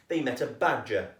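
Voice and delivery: ordinary delivery, nothing marked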